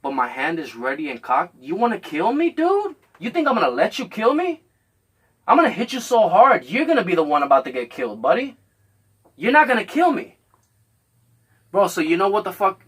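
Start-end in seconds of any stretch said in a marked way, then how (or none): 1.6-10.4 threatening challenge. Retold or imitated. Threatening the man with the weight, raised and fierce. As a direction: square up and threaten him.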